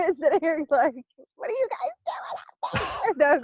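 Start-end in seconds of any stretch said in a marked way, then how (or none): 1.3-3.4 high-pitched imitation of yelling out; mock yelling. Joking about yelling at the guides, high mock yell. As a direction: call out high, half-joking. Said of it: "me yelling at the car".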